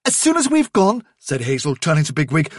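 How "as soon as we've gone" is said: high, quick urgent orders; rally the others fast; urgent commanding. Acted. Hazel's voice.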